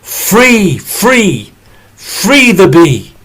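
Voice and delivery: loud, high rallying chant; neighbours crying out. Acted. Neighbours breaking the glass and chanting loudly to free the bee. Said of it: "broke the glass and cried".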